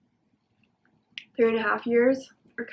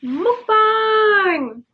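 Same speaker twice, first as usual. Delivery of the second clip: excited shout; 10 semitones higher, 8 dB louder than usual. Real, not acted. Excited shout of the show name.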